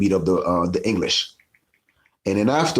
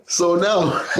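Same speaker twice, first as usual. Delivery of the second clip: laughing; 10 semitones higher than usual. Real, not acted. Speaker laughs at own bit.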